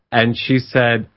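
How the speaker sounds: normal talking voice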